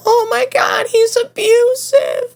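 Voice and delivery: hammed-up girlfriend accusing you; mock girlfriend. Girlfriend using a breakup excuse, mocking high girly voice. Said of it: "be like".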